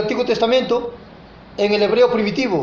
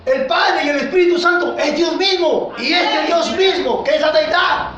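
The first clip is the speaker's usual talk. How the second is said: raised, emphatic sermon voice; fervent preaching; 5 semitones higher than usual. Acted. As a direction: preach it out with full conviction. Preacher exhorting the congregation. (predicación ferviente en voz alta)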